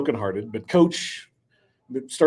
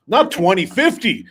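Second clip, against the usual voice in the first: loud emphatic; 6 semitones higher, 7 dB louder than usual. Acted. GM hyping drill demand.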